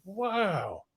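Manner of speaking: high, soft gasp of wonder; amazed wow. Retold or imitated. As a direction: breathe out the wow in awe. Retold moment of amazement.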